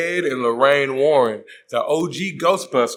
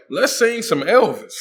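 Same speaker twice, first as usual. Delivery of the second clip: mock cheerful; 7 semitones higher, 3 dB louder than usual. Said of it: "And Ed is all like".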